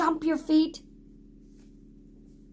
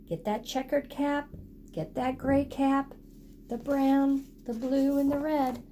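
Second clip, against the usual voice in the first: ordinary delivery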